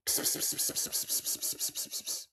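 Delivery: unvoiced psst sounds, fading; whispered psst. Mock mic test whispering.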